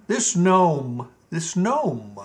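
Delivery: ordinary delivery, nothing marked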